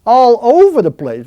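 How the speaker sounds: loud, high burst of candid admission; emphatic exclamation. Real, not acted. Speaker answering interviewer about his wandering mind, loud and emphatic. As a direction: burst out as if it were obvious.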